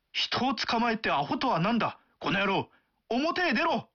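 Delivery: offended Tokyo man. Acted. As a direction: pick a fight over being called アホ. Offended Tokyo man picks a fight.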